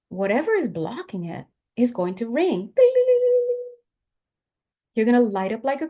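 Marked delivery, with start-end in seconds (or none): 2.6-3.7 high sung-out ding like a bell. Pretend. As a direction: ring it out high like a chime. Energy rings like a bell.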